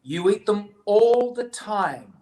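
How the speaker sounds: stretched-out motherly insistence; insistent mum. Acted. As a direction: correct a child emphatically. Mother bunny contradicts Betty.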